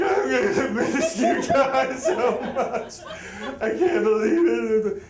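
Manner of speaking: high, slow, sobbing farewell; blubbering. Retold or imitated. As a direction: sob out the goodbye to the cats. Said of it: "and I'm blubbering".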